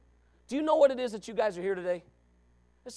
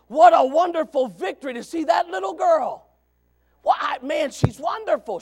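raised, loud preacher's exclamation; exultant preaching; 10 semitones higher, 10 dB louder than usual. Real, not acted. Preacher praising a little girl's victory, exultant preaching. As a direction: lift the voice high and proclaim it. Exclaims to congregation over child.

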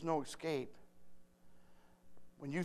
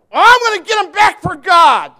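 shouted high and loud, hammed-up; mock shout; 13 semitones higher, 23 dB louder than usual. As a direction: yell it like a hothead bent on revenge. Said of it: "we want to say".

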